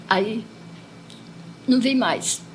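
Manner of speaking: ordinary talk, nothing marked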